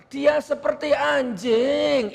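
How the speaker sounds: mock a limp, high, slow way of saying it; mock effeminate voice. Shown as a demonstration. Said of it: "Itu L G B T namanya". (meniru gaya kemayu, dipanjangkan)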